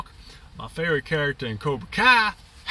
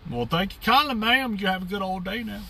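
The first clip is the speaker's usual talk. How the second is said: put-on country accent; 7 semitones higher than usual. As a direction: play the jolly Southern cowboy with a country accent. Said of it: "get you with my country accent".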